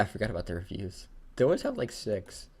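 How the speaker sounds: normal talking voice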